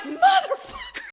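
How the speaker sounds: very high, loud comic shout; high-pitched cartoonish outburst; mock exasperated curse. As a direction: yell the swear in hammed-up outrage. Voicing exasperated God's curse.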